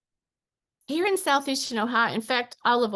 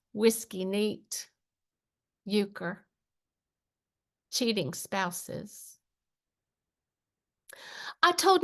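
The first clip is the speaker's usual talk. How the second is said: spaced-out verse, phrase by phrase; unhurried spoken-word recital; poem reading; slower than usual. Acted. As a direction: linger on each image, pause between them. Reads own poem aloud.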